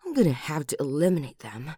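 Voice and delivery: cold threat. Acted. Vows revenge.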